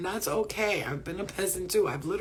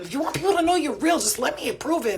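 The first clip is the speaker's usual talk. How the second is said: higher, fast, eager plea; pleading excited; 8 semitones higher, 5 dB louder than usual. Retold or imitated. Talking to her spirit guides, eager fast pleading. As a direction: beg them to let you prove it. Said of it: "I've been telling them".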